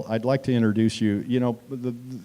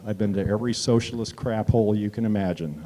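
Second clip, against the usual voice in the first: normal talking voice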